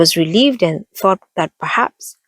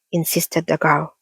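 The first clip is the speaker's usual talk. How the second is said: usual speaking voice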